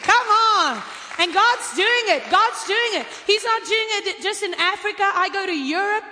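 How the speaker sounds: loud, high shouted praise; preacher whipping up the crowd with loud praise; preaching at full force. Acted. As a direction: shout it out to the congregation, drawn out.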